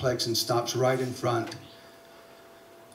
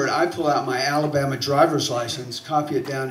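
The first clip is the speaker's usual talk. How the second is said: ordinary talk, nothing marked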